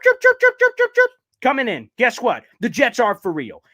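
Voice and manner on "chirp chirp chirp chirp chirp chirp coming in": mocking chirp. Real, not acted.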